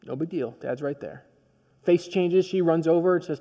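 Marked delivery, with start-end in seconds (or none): none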